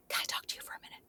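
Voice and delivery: unvoiced hushed ask on the sly; whispered request. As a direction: whisper it so nobody overhears. Castmates quietly asking to quit.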